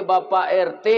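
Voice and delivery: usual speaking voice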